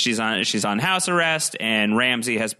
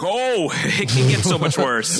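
Reek; 4 semitones higher than usual. Retold or imitated. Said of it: "He's like".

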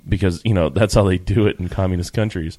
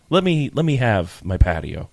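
normal talking voice

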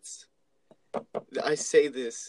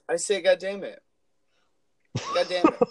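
higher, passionate mock child swearing; vigorous little daughter; 5 semitones higher than usual. Dad imitating his daughter defying her mother, vigorous and high. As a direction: imitate a defiant small child, pitched up. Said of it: "with such vigorosity and fucking passion".

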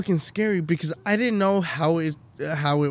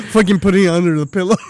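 ordinary talk

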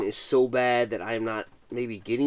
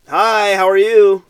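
excited greeting; 8 semitones higher, 12 dB louder, slower than usual. Real, not acted. Greeting a new viewer.

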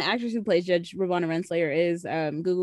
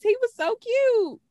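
high, drawn-out adoring squeal; gushing delight; slower than usual. Real, not acted. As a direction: squeal slowly over something adorable. Gushing over a cute detail.

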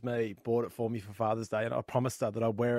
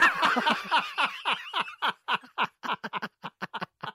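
long high laugh at own joke. Real, not acted. Teller laughs hard after the cowboy joke. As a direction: crack up at the punchline.

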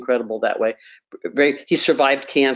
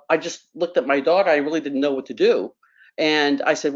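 ordinary delivery

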